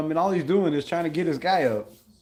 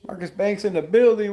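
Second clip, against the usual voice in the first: louder, higher welcome shout; hype shout-out; 5 semitones higher, 5 dB louder than usual. Real, not acted. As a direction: greet the viewer with big energy. Shouting out a chat viewer.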